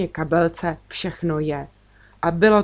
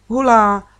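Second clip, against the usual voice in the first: put-on little boy's high, louder cheer; joyful cheer; 4 semitones higher, 6 dB louder than usual. A narrator's reading. Little brother cheers arriving at the fair, louder and higher. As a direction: cheer like a happy toddler. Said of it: "radoval se Tůfek".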